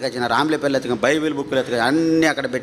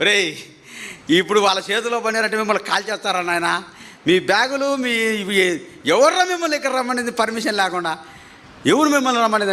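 a raised, scolding police officer's rebuke; scolding inspector; 7 semitones higher than usual. Retold or imitated. Police inspector scolds the arrested men, raised scolding voice. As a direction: tell them off like an annoyed inspector.

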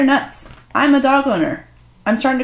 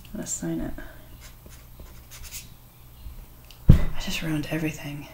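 real whisper of dismay; 4 semitones lower, 10 dB quieter than usual. Real, not acted. Vlogger realizing she signed the card wrong, whispered. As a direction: breathe it out in a whisper.

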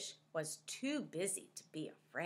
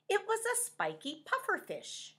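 ordinary delivery

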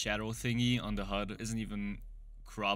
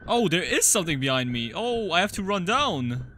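loud, higher, panicked; scared shout; 4 semitones higher, 7 dB louder than usual. Real, not acted. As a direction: yelp it out in real fright. Something behind him, must run.

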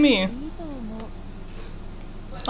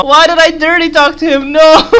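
high, loud wail of regret through laughter; half-laughing shriek of no; laughing panic; 7 semitones higher, 8 dB louder than usual. Real, not acted. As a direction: cry out at your own mistake. Panicking over stat jump.

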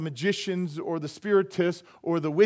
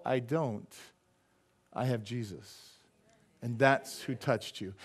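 softer, lower sincere answer; calm testimony; 6 semitones lower, 8 dB quieter, slower than usual. Retold or imitated. Pastor answering the amazed spiritist, soft and low. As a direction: quietly point him to Jesus. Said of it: "And I go".

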